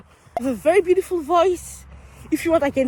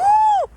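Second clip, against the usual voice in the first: a sharp high gasp of mock shock; 6 dB louder than usual. After his friend refuses to be filmed, a hammed-up gasp. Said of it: "I'm so shocked".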